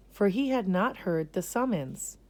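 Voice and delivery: usual speaking voice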